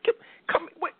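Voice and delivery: very high frantic mom voice; shrill mother cutting herself off; mom's shriek. Retold or imitated. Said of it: "She, like".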